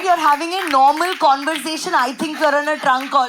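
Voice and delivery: high, loud, shouty conversation; loud talking. Retold or imitated. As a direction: imitate someone yelling into a phone. Loud boyfriend on a trunk call.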